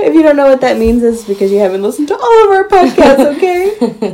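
loud, high-pitched mock telling-off; mock scolding. Teasing listeners about old podcasts.

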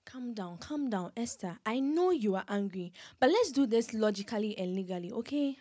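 hushed, calming undertone; soothing. Acted. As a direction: quietly talk an angry friend down. Colleague calms angry Esther.

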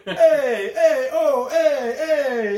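high, loud hammed-up Italian hey hey; mock Italian shouting. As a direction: yell hey like a cartoon Italian. S1 continuing Italian caricature.

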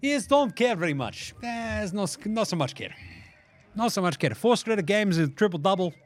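hammed-up Serbian accent. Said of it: "do it a Serbian accent".

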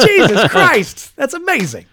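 praising the co-host's new word, delighted and high. Real, not acted.

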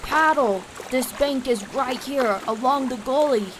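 loud, urgent bark; forceful shout, loudest on the first word; barked order. A narrator's reading. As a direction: bark out directions to a swimmer.

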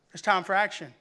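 ordinary talk, nothing marked

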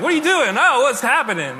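put-on a little boy spooked and lost; high, loud mock panic; panicked kid. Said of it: "I'd be like".

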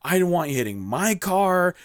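high, insistent retold complaint; exasperated arguing. Arguing with driver about hitting cars, exasperated.

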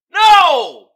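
loud emphatic "No!". Real, not acted. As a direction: shut the idea down hard. Rejecting the hater take.

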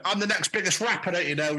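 high, cocky hustler voice; mocking a hustler. Traveller mocking a Times Square rapper, high cocky mimicry. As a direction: send up a street rapper's sales pitch. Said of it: "street hustlers quoted".

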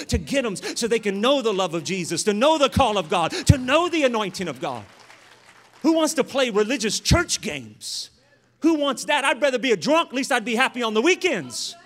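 loud, driving pulpit delivery; full-force preaching. Acted.

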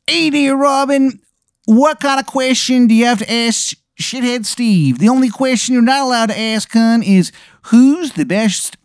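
high, loud, hammed-up Maryland accent; bad Maryland accent. Shithead Steve offering to answer any question, bad Maryland accent. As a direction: go way up in pitch with a terrible Maryland twang. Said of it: "it's the worst Maryland accent".

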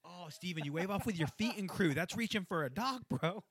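high, exasperated re-enacted objection; loud mock protest. Acts out his protest to Steven.

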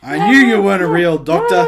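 loud mock accusation; accusing shout. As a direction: call him out loudly. Accusing the trapped doctor.

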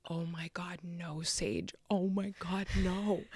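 friend's whispered horror; horrified whisper. Retold or imitated. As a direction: whisper it in shock.

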